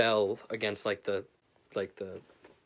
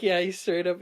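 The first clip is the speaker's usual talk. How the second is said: ordinary delivery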